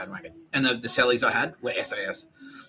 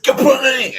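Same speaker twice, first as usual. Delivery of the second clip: a big high shouted clang sound effect; loud crash noise; 12 dB louder than usual. Retold or imitated. Recounting throwing a bollard into a windshield, a shouted clang. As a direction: belt out the bollard hitting glass. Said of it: "and I went".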